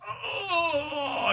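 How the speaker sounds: a yelp as he lands hurt; hurt cry. Retold or imitated. Namor lands hard, a high mock yelp of pain.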